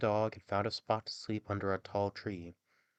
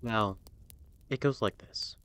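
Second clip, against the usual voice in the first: ordinary delivery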